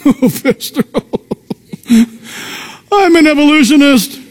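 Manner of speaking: lightly hammed-up objector, with a laugh; mock emailer. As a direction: send it up, laughing as you quote them. Mimicking an emailer to the pastor.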